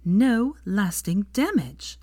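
slow, each word pressed; emphatic. A narrator's reading. Hermione insisting to Draco, slow and emphatic. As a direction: lay down the rule firmly. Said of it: "said Hermione emphatically".

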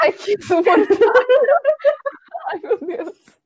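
high-pitched laughing outburst. Real, not acted. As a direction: laugh it out, exasperated. Real laughing after a teasing joke.

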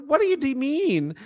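high, baffled question; high bewildered. Retold or imitated. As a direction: ask it like you can't believe it. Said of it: "I was like".

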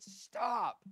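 high, loud exasperated holler; hollered stop. Retold or imitated. Weary walker halting the old preacher mid-scripture, hollering. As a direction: holler it to halt someone. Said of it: "the man just hollers".

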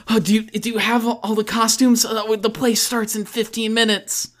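lightly voiced fretting guy, rushed; nervous IT guy. IT guy waiting outside for costumes, lightly nervous and quick. Wringing his hands.

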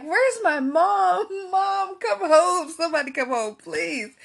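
half-laughing reenactment of calling for help; retold desperate plea. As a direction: call out for mom, then plead.

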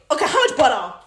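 loud, pestering question; impatient question. Acted. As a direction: call it out to the husband. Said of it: "now I gotta say".

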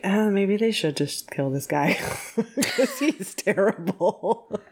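joking thought that breaks into laughter; laughing aside. Retold or imitated. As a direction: crack up while finishing the line.

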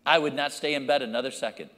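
ordinary talk, nothing marked

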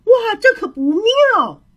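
high, drawn-out storybook yelp of alarm; slow, high exclamation of dismay; crying out. Acted. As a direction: cry out as the trapped hare. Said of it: "他大叫".

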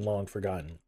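plain reading voice

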